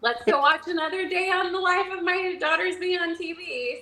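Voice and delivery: high, sustained mock-parent voice; imitated unfazed parents. Parents watching their daughter on TV, mock nonchalant. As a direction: pitch it up as a blasé parent. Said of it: "They're just like".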